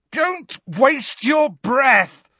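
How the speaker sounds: high, slow, drawn-out yell. A narrator's reading. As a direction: yell it scornfully. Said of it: "yelled Harry".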